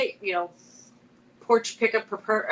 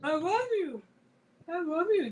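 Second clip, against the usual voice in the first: talking dog; 8 semitones higher, 5 dB quieter than usual. Retold or imitated. Owner quoting her talking dog, high doggy voice. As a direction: imitate a dog crooning words up high. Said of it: "that's what he said".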